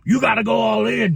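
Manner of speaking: hammed-up Jeff Jarrett yell; mock shout. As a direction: pump him up with a mock shout. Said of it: "Jeff Jarrett's like".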